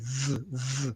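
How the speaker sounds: "V, V" said softly as a clean voiced v; soft drilled v sound; isolated V sound. Shown as a demonstration. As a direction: buzz the v sound on its own. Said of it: "It sounds like V".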